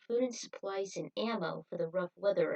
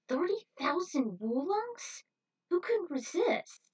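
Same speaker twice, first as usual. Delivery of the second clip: tempted; 10 semitones higher, 4 dB louder than usual. Acted. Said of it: "Spike said".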